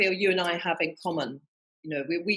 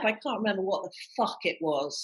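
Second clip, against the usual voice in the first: ordinary delivery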